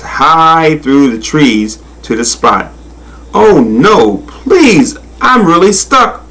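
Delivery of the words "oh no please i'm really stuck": higher, stretched acted plea; plead, pitch jumping up on the begging; pleading cry. A narrator's reading. Stuck crocodile begging the monkey, pleading cry. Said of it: "cried Karita".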